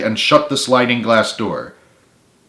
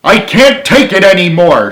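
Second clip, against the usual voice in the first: higher, louder exasperated cry; exasperated scream; 8 semitones higher, 8 dB louder than usual. A narrator's reading. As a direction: at the end of his rope. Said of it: "screamed Frank exasperatedly".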